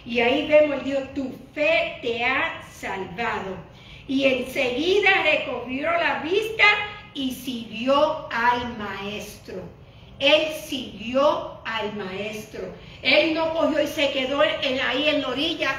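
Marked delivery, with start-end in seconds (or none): 1.1-3.7 solemn quote. Acted. Jesus's words, slowed. 7.1-12.7 very slow, drawn-out and low; solemn emphasis. Acted. Pastor stressing the healed man followed the Master, very slow and solemn.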